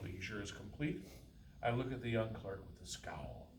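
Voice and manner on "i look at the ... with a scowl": usual speaking voice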